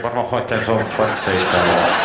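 a lightly voiced bloke asking loudly; gruff mock voice. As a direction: boom it out, half laughing. Quoting a man on plane.

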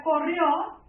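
corrió with the final ó held long; stressed syllable. Shown as a demonstration. Teacher showing the tonic syllable, corrió stretched on the final ó. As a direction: lean on the last syllable of corrió. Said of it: "aquí está la sílaba tónica".

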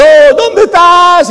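high, drawn-out anguished call; crying out to God. Retold or imitated. Preacher mimics believers yelling for God, slow high cry. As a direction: cry out to a God who feels far away. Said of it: "Y gritan".

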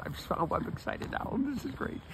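lightly voiced excited horse, pitched high; excited horse's voice. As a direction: speak up high for a happy horse. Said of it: "Oh, boy, he says".

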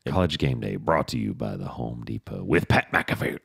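low, announcer-style sponsor plug; mock sponsor read. Co-host mock-announcing the show's sponsor, announcer voice.

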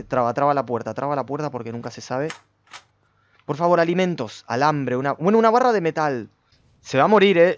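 ordinary talk

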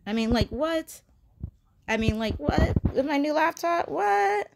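mock astonishment building up; playful disbelief. Real, not acted. Vlogger marvelling how cute they look by the laptop, rising mock disbelief.

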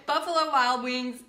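ordinary talk, nothing marked

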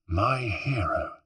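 slow, soft, cooing praise; softly flirtatious admiration; admiring. A narrator's reading. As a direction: gush gently at your rescuer, drawing it out. Rescued woman flattering her rescuer.

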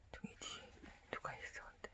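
hushed whisper. Real, not acted. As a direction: whisper it secretly. Whispered aside.

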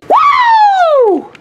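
a very high, squealing woo; excited cheer. Acted. Toy cheering after the ticket check, squealing high. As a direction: let out a thrilled little whoop.

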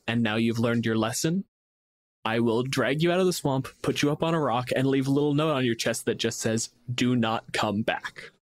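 ordinary talk, nothing marked

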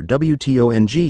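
each letter said separately, W T O N G; spelled out letters. A narrator's reading. Narrator spelling out the word wrong, each letter separate.

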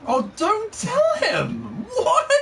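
high, laughing cry of protest; laughing shout. Real, not acted. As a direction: yell it through laughter. Friend gives away hiding place.

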